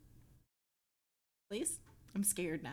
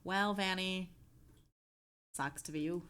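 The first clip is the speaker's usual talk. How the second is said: weak Canadian accent attempt; a hint of Canadian accent; bad Canadian accent. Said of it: "I'm doing a very bad Canadian accent".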